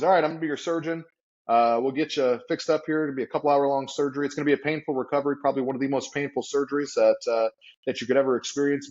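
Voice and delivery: fast, matter-of-fact doctor rattling it off; brisk surgeon. Retold or imitated. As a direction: breeze through it like routine. Surgeon quoted, brisk delivery.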